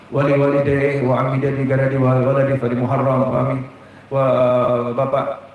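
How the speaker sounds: low, even recitation of names; prayer dedication. Real, not acted. Preacher dedicating the closing prayer to the departed, low recitation.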